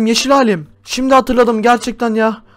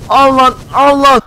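mock fight yell; 4 semitones higher, 4 dB louder, slower than usual. Hitting enemy in game fight.

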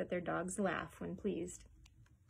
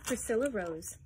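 ordinary delivery, nothing marked

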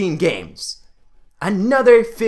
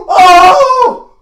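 high, loud whooping "oh"; ecstatic whooping laugh; 9 semitones higher, 10 dB louder than usual. Real, not acted. Gamer overjoyed by a lucky pull, whooping. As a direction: whoop with laughter at a huge win.

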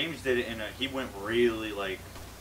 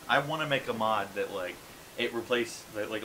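usual speaking voice

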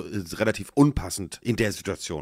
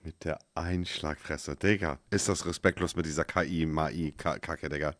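leise murmelnd; 8 semitones lower, 11 dB quieter than usual. Real, not acted. Fassungslos über KI-Stimme.

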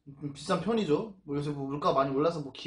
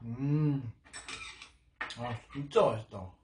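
chewing while talking; speaking between bites; mouth full of food; 4 semitones lower, slower than usual. Real, not acted. Seen 1: cheeks puffed, chewing meat throughout; food taken in at -1.

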